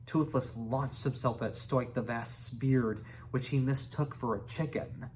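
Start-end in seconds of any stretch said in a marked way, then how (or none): none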